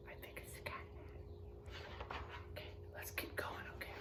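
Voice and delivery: soft secret whisper to the class. Real, not acted. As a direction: lean in and whisper. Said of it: "whispering to the class".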